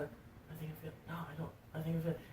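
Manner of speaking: put-on a quiet, half-hearted drunk mumble; mumbled. Drunk brother mumbling about his shaved head.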